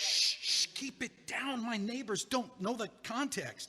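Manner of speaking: anxious hush before the neighbors hear; urgent shushing. Acted. Embarrassed neighbor begging his friend to stop yelling, urgently hushing. Hushing her loud complaint.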